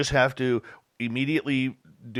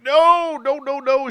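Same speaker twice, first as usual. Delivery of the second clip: loud, high, rapid-fire protesting no's; a burst of alarmed refusal; emphatic no; 14 semitones higher, 9 dB louder, much slower than usual. Retold or imitated. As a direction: shout them down before they go ahead. Protesting that Lorian is sick.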